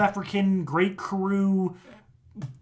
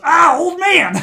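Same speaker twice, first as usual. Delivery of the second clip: loud, high terrified yell; scared shout; 11 semitones higher, 13 dB louder, slower than usual. Acted. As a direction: scream at the monster. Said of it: "and he goes".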